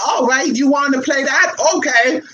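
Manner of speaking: mock shout of an eager boyfriend; excited mock shout. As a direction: call it out like a pumped-up man. Boyfriend's eager reply retold.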